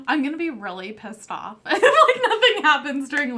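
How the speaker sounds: laughing, voice jumping high and loud. Real, not acted. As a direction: laugh through the words. Laughing while talking.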